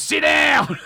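hammed-up shout at the man standing up; mock yell. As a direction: bark it like a fed-up heckler. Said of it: "He goes".

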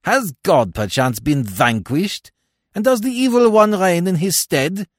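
loud, high, ringing outrage; harsh cry. A narrator's reading. Dead man rails at the fiend that woke him, harsh and loud. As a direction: rail harshly at full voice. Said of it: "cried Francois harshly".

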